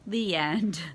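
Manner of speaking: ordinary delivery, nothing marked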